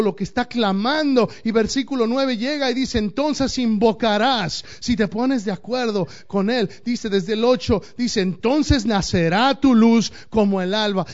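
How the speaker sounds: loud fiery sermon voice; forceful preaching. Acted. Pastor drives the point home to the congregation, forceful preaching. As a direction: preach it out hard.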